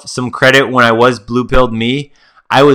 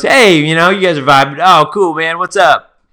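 higher-pitched chummy greeting; friendly dude; 6 semitones higher than usual. Acted. Strangers meeting on the beach, chummy. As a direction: lightly play an easygoing new pal.

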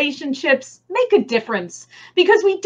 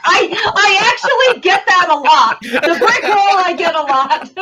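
talking through laughter; chuckling while speaking; laughing; 6 semitones higher than usual. Real, not acted. Guest laughing at a joke.

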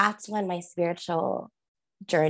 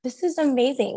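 amazed; 7 semitones higher than usual. Retold or imitated. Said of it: "And I was like".

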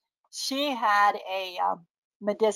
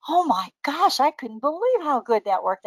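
higher-pitched gushing disbelief; imitating amazed customers; 6 semitones higher than usual. Customer calling back about an essence, imitated amazement. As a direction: act out a delighted caller. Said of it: "they'll call me up and they'll go".

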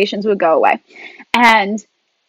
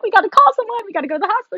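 high-pitched panicky imitation; hammed-up urgent alarm; mock freak-out; 11 semitones higher, much faster than usual. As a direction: blurt it as if flipping out. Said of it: "he flips out".

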